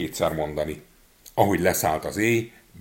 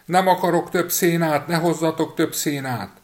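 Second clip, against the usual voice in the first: high, loud shouting; boy yelling from the cow's belly; yelling again; 9 semitones higher, 5 dB louder than usual. A narrator's reading. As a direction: shout it at the pastor. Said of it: "ismét kiabálni kezdett".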